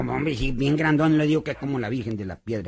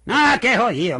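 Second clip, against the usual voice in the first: much higher, louder scornful outburst; loud scoff; 9 semitones higher, 8 dB louder than usual. Acted. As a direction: brush off the fear boldly. Chico scorns the eavesdroppers.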